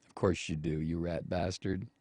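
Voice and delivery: low, soft, dry aside; muttered contempt. Real, not acted. Host answers the senator's claim, low contemptuous aside. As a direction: drop low and mutter the insult.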